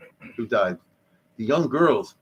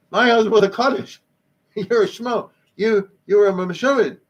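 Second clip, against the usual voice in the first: indignant widow; 7 semitones higher, 4 dB louder than usual. Retold or imitated. Widow retorts to suitor.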